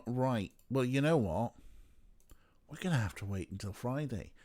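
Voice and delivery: ordinary delivery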